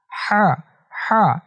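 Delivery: হা from the middle of the throat, breathy ħ; হা as Arabic ħ. Shown as a demonstration. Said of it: "কণ্ঠনালীর মাঝখান থেকে".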